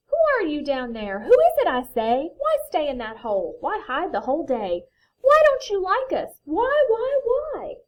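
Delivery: loud, high storybook demands; insistent questioning. A narrator's reading. Friends to stranger in the hole, insistent calling. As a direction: pile up the questions, climbing to a plea.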